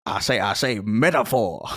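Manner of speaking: cartoon Southern rooster voice; Foghorn Leghorn. Co-host joking as a Looney Tunes chicken, Southern rooster impression. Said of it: "what's that chicken from Looney Tunes?".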